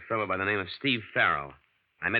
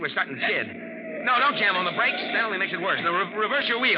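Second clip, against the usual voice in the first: higher, louder urgent commands to the driver; urgent alarm; 11 semitones higher, 4 dB louder, faster than usual. Acted. Friend shouting orders to the driver in a skid, alarmed. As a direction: bark instructions as the car slides. Car skidding on mountain road.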